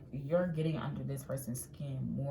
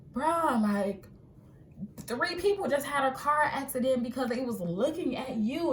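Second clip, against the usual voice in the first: high, loud, wide-eyed bro voice; a pitched-up disbelieving friend; amazed friend; 9 semitones higher, 5 dB louder than usual. Pretend. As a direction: gush over it like a stunned buddy. Friend marvelling at your looks.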